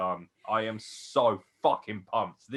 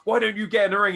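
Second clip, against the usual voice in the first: challenging taunt; 11 semitones higher, 7 dB louder, faster than usual. Retold or imitated. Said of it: "he's like".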